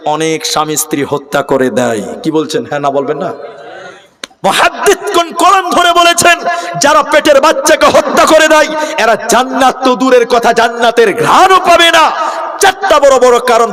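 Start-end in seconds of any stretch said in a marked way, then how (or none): 4.4-13.7 high, loud, shouted sermon; full-force preaching. Real, not acted. Preacher warning those who kill the unborn, impassioned high shouting. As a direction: thunder the warning out to the whole crowd.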